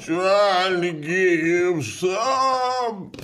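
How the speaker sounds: very high, very slow ghostly drawl; zombie. Pretend. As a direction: moan it out like a ghost. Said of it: "anybody in that voice".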